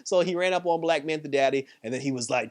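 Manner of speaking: ordinary talk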